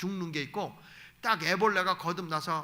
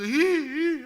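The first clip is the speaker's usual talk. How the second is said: high, louder fake whimpering cry; mock child whimper; 10 semitones higher, 8 dB louder, much slower than usual. Preacher as a boy crying to his father, fake whimper. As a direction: whine like a kid crying for money. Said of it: "하고 울었어".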